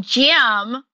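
a hint of Tammy Faye's folksy Minnesota accent; cute, light Minnesota accent. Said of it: "the way she said Jim".

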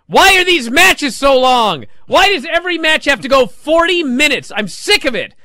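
loud, high whining protest; hammed-up fed-up rant; complaining. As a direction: complain in exasperation, stretching the first question. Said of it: "the first guy that complains".

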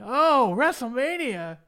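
high, drawn-out imitation of a yell; mock yelling. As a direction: holler it out in mock excitement. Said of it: "you hear May yelling".

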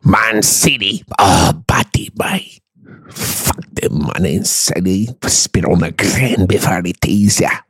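halting, muttered mock Scots; low-key exaggerated Scottish impression; Scottish accent. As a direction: grumble slowly in thick Scottish. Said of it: "That's Scottish".

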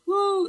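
high loud woo cheer; yelled woo. Retold or imitated. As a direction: whoop from the audience. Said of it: "They yelled".

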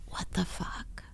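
hushed, voiceless swear whisper. Pretend. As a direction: whisper it like a secret. Whispered phrase for segment.

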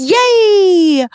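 high, loud squeal of joy; squealed yay. Acted. Squealing yay at the topic reveal. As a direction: cheer it way up high.

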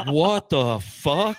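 slow, drawn-out laughing disbelief; stunned laughing curse. Retold or imitated. Rider watches the boat shrink, slow laughing disbelief. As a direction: stretch it out through a laugh. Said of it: "And I'm like".

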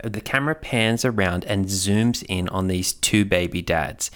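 normal talking voice

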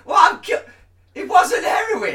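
mock outraged yell. Poor resident complaining about vaccine.

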